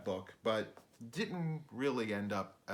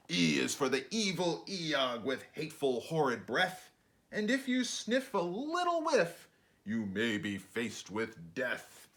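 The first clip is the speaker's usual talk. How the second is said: put-on lively sing-song read-aloud for kids; bouncy picture-book narration; rhyming storybook reading; 5 semitones higher, 3 dB louder than usual. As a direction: read it like a bedtime rhyme to children.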